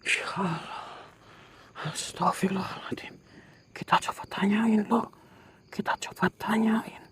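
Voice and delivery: loud scared whisper; frightened whisper. Real, not acted. Whispering in terror beside the ghost, scared whisper. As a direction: gasp it out in a panicked whisper.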